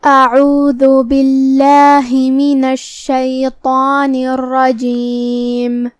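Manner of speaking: slow, drawn-out melodic tajweed chant; Quran recitation. Shown as a demonstration. Teacher reciting the verse for students, in slow melodic recitation. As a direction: recite each syllable long and measured. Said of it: "تو شروع کرتے ہیں".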